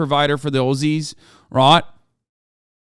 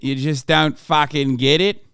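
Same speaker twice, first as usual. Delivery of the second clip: normal talking voice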